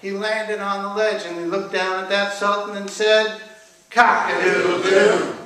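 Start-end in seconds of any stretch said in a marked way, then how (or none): 3.9-5.4 loud, slow crowing chant; drawn-out rooster chant; rooster crowing demand. A narrator's reading. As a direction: crow the refrain with the audience. Rooster leads crowed refrain again.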